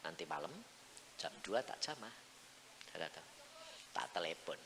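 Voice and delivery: low, hushed answer from God; quiet promise. Acted. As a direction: promise it very softly. Janji Tuhan disuarakan pelan. (janji yang diucapkan pelan)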